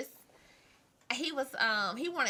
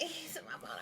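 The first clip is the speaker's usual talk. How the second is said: whispered with a laugh; 6 semitones higher, 10 dB quieter than usual. Real, not acted. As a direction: whisper it half-laughing at the memory.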